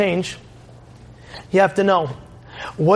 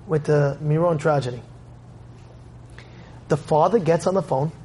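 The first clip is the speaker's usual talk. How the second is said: usual speaking voice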